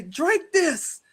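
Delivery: put-on high-pitched Jamaican-accented order; Mama Africa. Drink seller offering juju juice, high Jamaican accent. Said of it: "like, a Jamaican voice".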